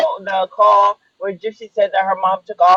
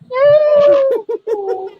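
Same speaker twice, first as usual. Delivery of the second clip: high-pitched squeal. Retold or imitated.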